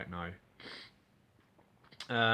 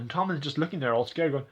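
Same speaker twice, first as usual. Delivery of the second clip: usual speaking voice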